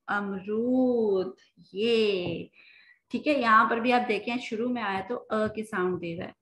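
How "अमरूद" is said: अमरूद very slow, the ऊ in रूद held long; long oo stretched. Shown as a demonstration. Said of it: "ऊ की sound दे रहा है अमरूद". (अमरूद में ऊ लंबा खींचकर)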